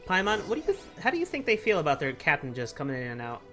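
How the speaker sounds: ordinary talk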